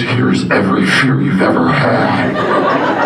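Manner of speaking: low, slow menacing brain voice; sinister inner voice. Acted. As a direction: draw it out like a looming nightmare.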